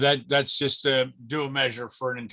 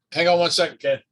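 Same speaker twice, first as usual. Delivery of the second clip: raised voice calling to someone away; yelling across the room; 5 semitones higher, 7 dB louder than usual. Real, not acted. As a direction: call out loudly over the room. Breaks off to answer wife.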